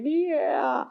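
high, teary little "yeah"; a hint of a sobbing toddler; sobbing child. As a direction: son admitting it, small teary child voice. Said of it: "he's sobbing".

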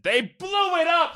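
high, slow, hammed-up shout of despair; mock movie shout. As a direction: bellow it like the Planet of the Apes hero. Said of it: "I said".

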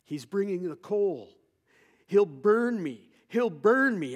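high, loud, slow cries of terror; retold terrified scream. Dying man screaming in terror at the coal. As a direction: cry out like a dying man seeing fire. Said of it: "the man starts to, to scream".

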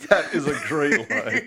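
loud, laughing appreciation. Real, not acted. Host praising comedian's punchline, laughing. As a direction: say it through real laughter.

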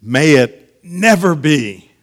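high, loud, slow "May it never be"; emphatic. Acted. Preacher giving the Greek of Paul's answer, emphatic "May it never be". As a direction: spread out "May it never be", each part forceful. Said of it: "And it's emphatic".

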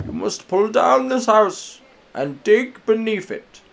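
put-on high, slow, quavering old man voice. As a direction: totter through it like an ancient elder. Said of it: "said the old man".

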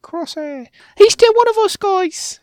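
put-on young girl pleading with her squad; Omega pleading. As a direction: beg the team like a kid sister.

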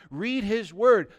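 usual speaking voice

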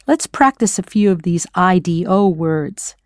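usual speaking voice

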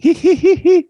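loud, very high mock giggle; Mika's chuckle. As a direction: mimic a tittering chuckle, squeaky and mocking. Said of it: "little Mika chuckled".

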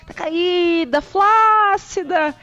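high, loud, drawn-out lament; mock pitying wail. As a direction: wail over it like a pitied thing. Hamming up sagging savings. (lamento exagerado e arrastado)

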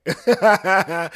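loud, laughing, proudly owning it; laughing unbothered yeah. Retold or imitated. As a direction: toss it off like it's a compliment. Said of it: "He might just tell you".

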